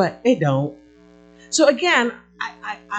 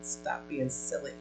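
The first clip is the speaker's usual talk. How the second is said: hushed scolding; 5 semitones lower, 12 dB quieter, slower than usual. Real, not acted. Preacher scolds congregation, hushed.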